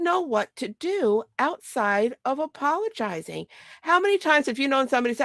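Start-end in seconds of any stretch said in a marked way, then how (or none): none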